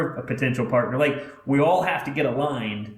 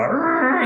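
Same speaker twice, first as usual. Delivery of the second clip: engine revving; 5 dB louder than usual. Retold or imitated. Grandpa speeding the truck.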